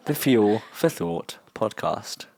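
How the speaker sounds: slow, deliberate enunciation; careful retake. Real, not acted. Host retrying the fumbled podcast name, slow and careful. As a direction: take each word slowly and carefully.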